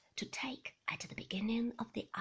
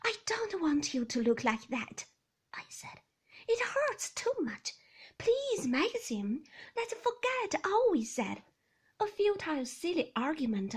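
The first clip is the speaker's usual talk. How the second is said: much higher, tearful pleading; pleading wife; 6 semitones higher, 7 dB louder than usual. A narrator's reading. Young wife begging Maxim to forget the quarrel, high and tearful. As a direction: beg him to forgive.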